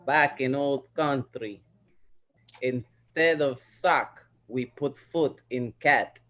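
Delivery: slow mock Russian accent; deadpan Russian-accented old-timer. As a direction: reminisce slowly in a put-on Russian accent. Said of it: "like my Russian".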